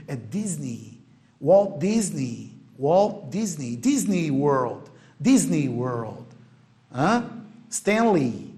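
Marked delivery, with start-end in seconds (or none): 0.1-1.0 Disney with a final "ee"; long I ending. Shown as a demonstration. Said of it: "Não é Disney". 1.4-6.3 Disney ending in a long "ee". Shown as a demonstration. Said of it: "palavra terminada em E Y é um I longo". 7.7-8.5 Stanley ending in a long "ee". Shown as a demonstration. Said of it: "palavra terminada em E Y é um I longo".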